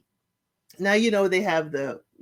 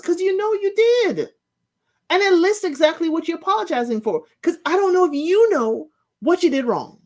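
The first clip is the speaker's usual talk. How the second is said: much higher, pointed lecture; high-pitched indignant telling-off; exasperated scolding; 10 semitones higher than usual. Real, not acted. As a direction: scold her like she should know better. Scolding the cast member.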